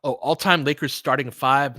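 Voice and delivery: ordinary delivery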